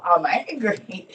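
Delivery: loud, slow mock yell; retold scream. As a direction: shout the anger, drawn out. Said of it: "I woke up screaming loud".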